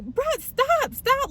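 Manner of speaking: high-pitched retold plea to stop; exasperated protest. Girlfriend telling screaming drunk boyfriend to stop, high exasperated protest.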